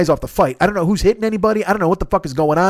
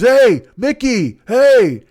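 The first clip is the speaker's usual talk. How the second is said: high, loud, drawn-out call; yelling to a friend; 5 semitones higher, 5 dB louder, much slower than usual. Retold or imitated. Passenger calls his friend from the car, imitated yell. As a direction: holler to get a friend's attention. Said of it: "he yells at his friend".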